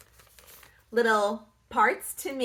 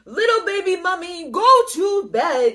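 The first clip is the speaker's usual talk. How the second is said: fast, louder warning; bossy monster; 6 semitones higher, 8 dB louder than usual. Acted. The glob warns baby mummy, fast and firm. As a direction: shoo the little one off to bed.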